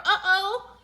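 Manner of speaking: high, loud uh-oh; alarmed. A narrator's reading. Arthur dreading who is outside the tent, alarmed. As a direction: blurt it out in alarm.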